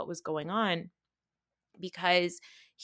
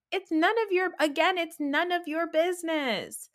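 high, loud scolding; exasperated scold; 10 semitones higher, 3 dB louder than usual. Real, not acted. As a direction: tell them off sharply. Scolding cast members.

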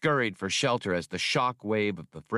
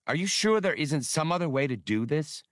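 higher, worried plea in a lightly voiced girl; objecting; 6 semitones higher than usual. A narrator's reading. Girl objects to Han's plan, higher anxious protest. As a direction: push back, uneasy and pleading. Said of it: "The girl objected".

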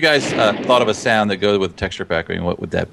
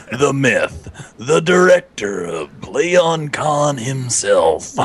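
movie trailer voice; 2 semitones higher, much slower than usual. Acted. Guest grandly introduces the convention director, slow movie-trailer announcer. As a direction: draw out each phrase, then soar on the final name. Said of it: "You do the movie voice?".

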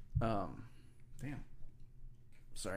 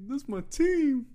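high, loud whiny fan voice; mock proud fan; 6 dB louder, much slower than usual. Mimicking Andre's fan pride.

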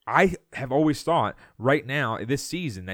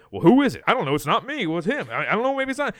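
higher, loud start, mock coaches passing blame; hammed-up bickering coaches, up in pitch; 7 semitones higher, 4 dB louder, faster than usual. Host acts out coaches pointing fingers like the meme, in higher mock voices. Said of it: "all the coaches say".

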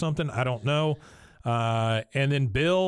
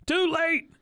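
Dale gloating; 12 semitones higher than usual. Retold or imitated. Dale yelling from tower.

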